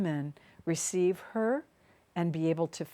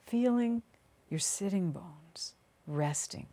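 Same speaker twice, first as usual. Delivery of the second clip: unhurried meditation voice with long pauses; guided meditation; 2 semitones lower than usual. Acted. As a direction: guide them gently down into the body, phrase by phrase.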